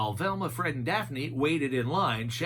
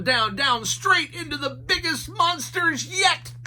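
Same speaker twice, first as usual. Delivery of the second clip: plain reading voice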